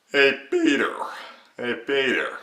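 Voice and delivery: Patrick Warburton. Shown as a demonstration. Tutor demonstrating the mid-range catchphrase, loud Warburton impression. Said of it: "simple mid-range one of just saying".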